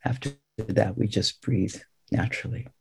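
soft, spacious meditation voice; guided meditation. Real, not acted. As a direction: leave long silences between short phrases. Guides breath counting in meditation.

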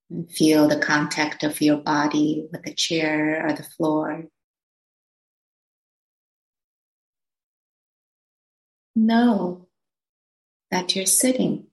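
slow phrases with long pauses; spacious, measured meditation guidance; guided meditation. Acted. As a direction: gently guide listeners into settling, unhurried.

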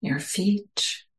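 measured meditation voice, phrase by phrase; guided meditation. Real, not acted. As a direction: guide listeners into stillness, leaving space between phrases.

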